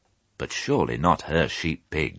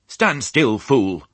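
sharp, higher, louder command to a sheep; barking order; 6 semitones higher, 5 dB louder, much slower than usual. A narrator's reading. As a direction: bark it like a stern sheepdog. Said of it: "she barked".

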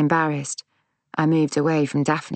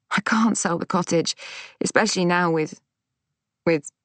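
faltering upset; 3 semitones higher than usual. A narrator's reading. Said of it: "I couldn't bring myself to say Neil's name".

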